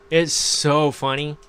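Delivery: normal talking voice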